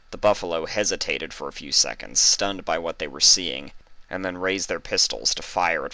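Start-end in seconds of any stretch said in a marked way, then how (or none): none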